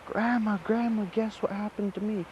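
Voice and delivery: high, breathless rush to tell grandma; bursting with news, calling for his grandma; excited. Acted. Boy runs home to grandma, high excited calling. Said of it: "and he goes".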